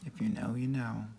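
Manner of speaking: whispered knowing repeat; hushed aside. Real, not acted. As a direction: drop to a secret whisper.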